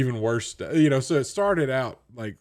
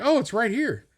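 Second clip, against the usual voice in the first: high-pitched mock cashier; raised sing-song clerk; 13 semitones higher than usual. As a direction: chirp it like a cheery cashier. Cashier quoted.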